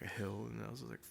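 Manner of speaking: usual speaking voice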